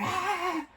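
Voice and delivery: very high, thin scare cry; mock shriek. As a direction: jump out with a squeal. Sam scares the kids.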